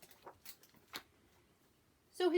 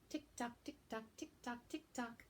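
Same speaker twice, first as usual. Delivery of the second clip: soft ticking; 4 semitones higher, 8 dB quieter than usual. A narrator's reading. Clock ticking in story.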